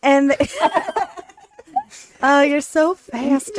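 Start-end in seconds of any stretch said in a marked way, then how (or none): 0.4-3.6 laughing through the words, slowed down; delighted laugh at a quick joke. Real, not acted. Laughing at friend's quick pun.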